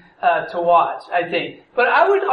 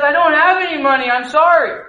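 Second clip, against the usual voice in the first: higher, louder, fast desperate apology; pleading servant; 6 semitones higher, 4 dB louder than usual. Acted. Servant pleading with the king for mercy, high and fast. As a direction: beg the king not to punish you.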